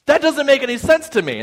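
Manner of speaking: high-pitched mock-panicked protest; alarmed disbelief. Scared passenger answering the stewardesses' explanation, high-pitched disbelief.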